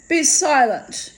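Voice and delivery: put-on an old lady snapping before she can stop herself. A narrator's reading. Old lady silencing gossiping guests, a sudden loud outburst. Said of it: "spoke louder than she had intended".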